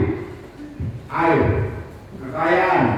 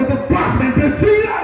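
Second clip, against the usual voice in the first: angry shout; 7 semitones higher than usual. Real, not acted. Cursing officials at protest.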